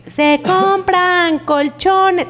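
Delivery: high, very slow street vendor call; crackly looped vendor recording. Retold or imitated. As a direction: stretch it like a loudspeaker truck. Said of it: "pasó una así de que".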